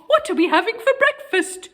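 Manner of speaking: put-on high, quick old lady question; cheerful granny voice. A narrator's reading. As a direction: ask it like a chirpy grandma. Granny speaks.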